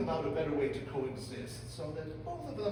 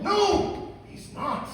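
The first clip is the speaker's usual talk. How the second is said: loud sharp no; shouting; 9 dB louder, much slower than usual. Acted. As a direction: yell it at him. Therapist yelling answer.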